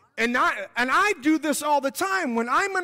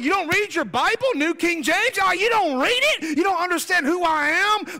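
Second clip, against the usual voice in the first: raised, loud, scornful rebuke; indignant angel; 5 semitones higher, 3 dB louder than usual. Acted. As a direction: scold him like an insulted archangel. Gabriel rebuking Zechariah.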